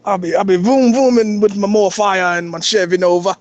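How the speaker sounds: comic put-on Jamaican accent, close to laughing; Jamaican car thief. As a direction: brag in hammed-up Jamaican patois. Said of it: "here's my Jamaican, uh, uh".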